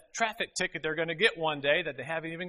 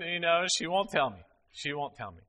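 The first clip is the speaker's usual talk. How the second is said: ordinary talk, nothing marked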